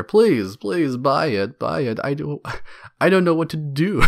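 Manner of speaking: whiny, drawn-out please; mock begging. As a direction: plead pathetically, half-laughing. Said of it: "They're begging".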